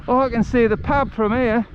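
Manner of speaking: high, chirpy Aussie bloke, pleased with himself; delighted Aussie accent spotting the pub. Retold or imitated. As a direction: deliver it in a cheeky Aussie accent. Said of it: "That's my Aussie accent".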